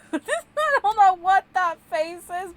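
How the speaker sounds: high, loud giggly outburst; squealing through laughter at the face; laughing while talking. Real, not acted. Laughing at a funny pumpkin face.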